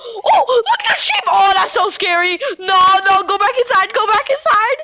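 high panicked yelling; yelling while running away. Real, not acted. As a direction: shout in fear while fleeing. Fleeing mob in panic.

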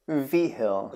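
"Vigil" said slowly as vee-hill; "Vigil" with an h sound for the g; name pronunciation demo. Shown as a demonstration. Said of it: "Hey, say it, uh, tell us".

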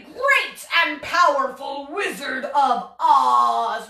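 slow booming wizard voice; powerful wizard. Shown as a demonstration. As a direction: announce it like a mighty wizard. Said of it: "what we did for our Wizard of Oz pose".

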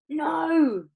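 high, loud cry of protest; Charlie shouting. A narrator's reading. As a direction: shout it, voice lifted. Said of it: "shouted Charlie".

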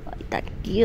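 hushed whisper while hiding. Real, not acted. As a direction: whisper so it won't hear. Hushed near creature.

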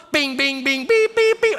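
high-pitched rapid beeps; imitated censor bleeps. Swearer lets loose before a pastor, imitated bleeps.

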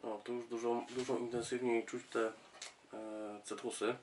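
talking just over wine glass; speaking with glass near mouth. Real, not acted. Seen 1: Glass held just below mouth early, then lowered; sniffing, not drinking.

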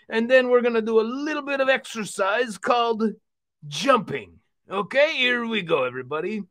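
hearty exercise-show puppet host; rally everyone like a cheery cartoon uncle; lively uncle character, punching the calls to action; jovial puppet uncle. Puppet Uncle Vino hosts workout.

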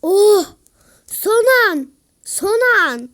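loud high last-moment yell; excited cry. Real, not acted. Gamer cheering a last-second save. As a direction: shout it with relief.

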